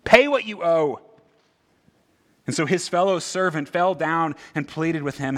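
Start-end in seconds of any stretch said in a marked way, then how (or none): 0.0-1.0 loud, demanding shout at the debtor; angry yell. Retold or imitated. Forgiven servant yelling at his debtor, loud demand. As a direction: bark the demand like a furious creditor. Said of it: "yells at him".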